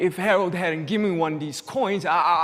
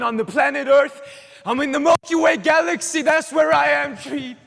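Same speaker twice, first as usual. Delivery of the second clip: high, loud exultant cry; triumphant shout; 8 semitones higher, 6 dB louder than usual. Acted. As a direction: build up and shout it out. Triumphant declaration, building to shout.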